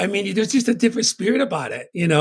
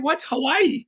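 put-on puzzled kid question; 9 semitones higher, slower than usual. Retold childhood talk with mom.

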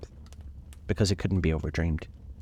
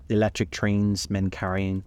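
ordinary delivery

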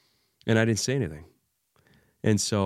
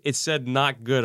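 "not" drawn out, slow and higher; stress on not; 6 semitones higher than usual. Retold or imitated. Dad realizing he misread the text, slow stress on "not". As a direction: land hard on "not", slowly. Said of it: "I'm like".

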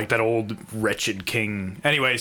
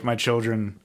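usual speaking voice

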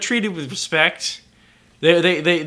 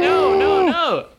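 shocked protest; slower than usual. Real, not acted. Loud high protest at a joke.

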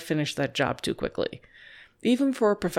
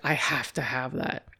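dog's craving voice; 4 semitones lower, 3 dB quieter than usual. Pretend. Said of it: "they just like".

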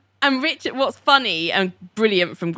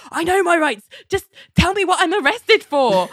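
high-pitched, hammed-up outrage; mock indignant protest; 11 semitones higher than usual. [name] protesting to police with hands up, mock indignant. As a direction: protest your innocence, pitched right up.

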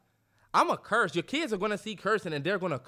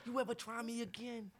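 very soft, raised-pitch menace; hushed mock threat; 5 semitones higher, 11 dB quieter than usual. Dad warning his son after a stomach shot, hushed threat.

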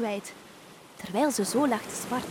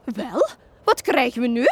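surprised exclaim; 2 semitones higher, 6 dB louder than usual. A narrator's reading. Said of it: "riep Fiona uit".

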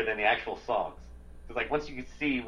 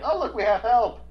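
higher and louder, mock-cheerful robot voice; mock happy robot; 10 semitones higher, 7 dB louder than usual. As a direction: squeal it like a relieved cartoon robot. Voicing cheerful robots in movie.

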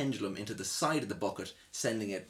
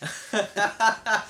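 mock breezy; 13 semitones higher, 10 dB louder than usual. Real, not acted. Ironic punchline.

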